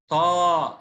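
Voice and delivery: طا with a long, held "aa"; long vowel. Shown as a demonstration. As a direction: stretch the vowel in طا. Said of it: "صوت طويل".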